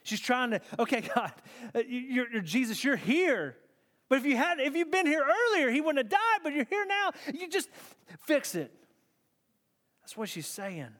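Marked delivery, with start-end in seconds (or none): 0.8-8.7 halting, then climbing high and insistent; confused pleading. Retold or imitated. Martha trying to figure it out with Jesus, confused pleading. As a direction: stumble into an urgent, high-pitched plea. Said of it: "Even in her confusion, she's trying".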